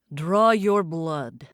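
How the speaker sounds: slow, deep commanding order; loud command. Acted. Demon leader commanding his followers, slow and deep. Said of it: "Isko's loud voice commanded".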